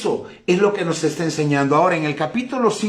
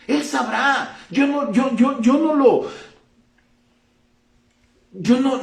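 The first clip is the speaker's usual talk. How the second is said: pitched up, fervent insistence; fervent rise; 7 semitones higher than usual. Real, not acted. Preaching about not trying to understand, fervent and higher. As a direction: lift the voice in conviction.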